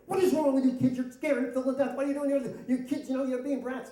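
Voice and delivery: high-pitched angry dad tirade; retold exasperated yell; yelling at kids. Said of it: "I'm yelling at them".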